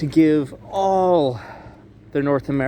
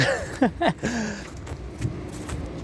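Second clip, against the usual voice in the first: breathy laugh; 9 semitones higher, 6 dB quieter than usual. Real, not acted. Laughing at frozen hands.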